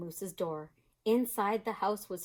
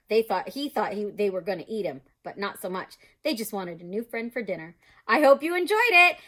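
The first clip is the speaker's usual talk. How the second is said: ordinary delivery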